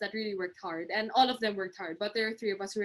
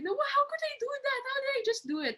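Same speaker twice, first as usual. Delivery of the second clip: disbelieving surprise; faster than usual. Retold or imitated. Retold disbelief at finishing work.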